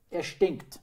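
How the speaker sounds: high, loud, decisive verdict; firm woman's complaint. A narrator's reading. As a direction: pronounce it emphatically. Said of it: "sagte die Frau noch bestimmter".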